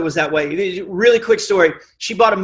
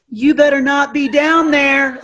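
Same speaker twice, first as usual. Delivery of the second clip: high, slow, unthreatening warning; mock tough warning; 8 semitones higher, slower than usual. Shown as a demonstration. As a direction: try to sound scary and fail. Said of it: "would this scare you".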